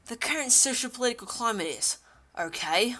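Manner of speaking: usual speaking voice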